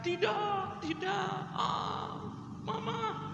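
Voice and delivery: a hint of hysterical graveside wailing; mock wailing woman. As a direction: play a grieving woman crying out for her mother. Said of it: "Teriak-teriak".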